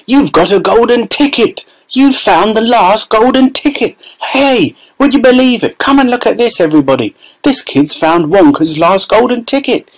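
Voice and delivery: very high, giddy shouting shopkeeper; excited screaming. A narrator's reading. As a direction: call the whole street over in delight. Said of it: "screamed the shopkeeper".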